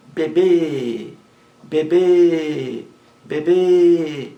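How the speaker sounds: "bebê" with the final bê loud, high and stretched; stress on last syllable. Shown as a demonstration. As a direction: lean hard and long on the end of "bebê". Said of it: "A sílaba mais forte é a última".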